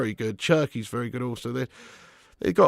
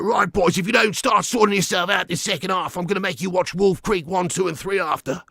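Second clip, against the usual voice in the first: Big Ange threat; 5 semitones higher than usual. Retold or imitated. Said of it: "It's like".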